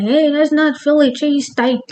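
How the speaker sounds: hammed-up Philly complainer; attempted Philly accent. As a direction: badly fake an objecting Philly local. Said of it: "I don't know the accent".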